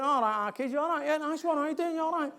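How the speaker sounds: mock Oldham accent. Speaker mimicking how the Times heard him, mock Oldham accent. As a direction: imitate quick-fire Oldham greetings. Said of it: "reckon they went".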